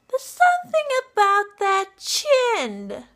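high, slow squeaky teasing line; Harley Quinn voice. Retold or imitated. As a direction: tease in a sweet squeak. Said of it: "Harley Quinn impression".

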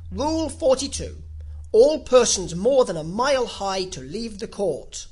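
loud, formal royal proclamation; reading out. A narrator's reading. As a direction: proclaim the rule to the whole court. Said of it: "read out from his book".

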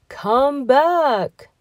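high, loud cow's call. A narrator's reading. Cow calls after the gingerbread man, high and loud. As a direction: call him back like a cow. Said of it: "said the cow".

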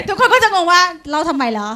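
laughing. Real, not acted. Teacher giggles about telling story.

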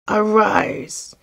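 loud, menacing genius's cry; terrible voice. A narrator's reading. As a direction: command it as an enraged, terrible genie. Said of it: "he cried in a terrible voice".

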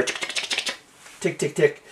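rapid run of whispered clicks, then voiced ticks; imitated hammer ticks. Shown as a demonstration. Programmer showing the shortened sound, imitated hammer ticking. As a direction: mimic a teletype hammer ticking. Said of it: "a little more like a hammer".

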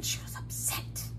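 whispered aside, no voice. Acted. Reader whispering to the children about Billy's mood. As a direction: hush it like a secret to the kids.

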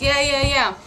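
loud, dismissive yeah yeah. Retold or imitated. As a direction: brush him off, loudly. Brushing him off while cooking.

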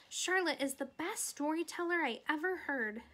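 put-on gushing little girl. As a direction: pipe up like an enthusiastic child. Girl gushing over spider's stories.

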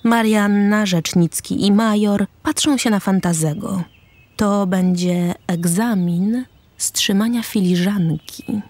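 ordinary talk

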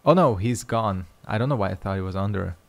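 normal talking voice

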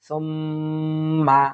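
slow chanted syllables with held m; ghunnah recitation. Shown as a demonstration. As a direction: join the syllables in slow tajweed. (গুন্নাহ করে ধীরে তেলাওয়াত)

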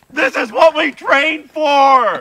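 high, drawn-out, full-voice holler; mock battle cry. Joking as a home defender under attack, long loud rallying shout. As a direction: yell it like rallying the troops.

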